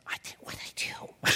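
sheepish whispered reply; mock timid whisper. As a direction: whisper it like a scolded kid. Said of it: "I said".

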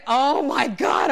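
slow, loud mock dismay; exerted outcry. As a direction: cry out in the middle of a climb. Panics on the next hill.

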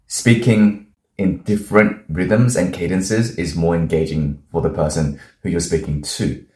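usual speaking voice

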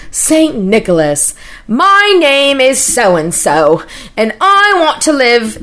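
slow, grand letter-writing voice; stretched-out, put-on formality; fancy. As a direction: read your letter aloud, slow and fancy. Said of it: "I wanted to be real fancy".

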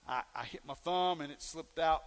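normal talking voice

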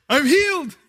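put-on high, delighted kid's voice; a small boy proudly declaring himself cured; little boy voice. Son telling dad he's better, little boy voice.

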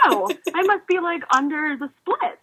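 light, high-pitched replay of a happy discovery; retold excited realisation. Swimmer realising mid-race she is ahead, excited.

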